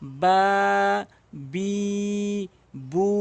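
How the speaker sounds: বা, বি, বু each held one alif long; drawn out syllables. Shown as a demonstration. Teacher chanting বা, বি, বু slowly for students. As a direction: stretch each vowel of বা, বি, বু evenly. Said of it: "এভাবে টেনে টেনে পড়বেন".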